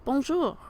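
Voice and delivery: Bonjour modelled high and clear; model pronunciation. Shown as a demonstration. Teacher saying Bonjour for students to repeat. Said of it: "Repeat after me".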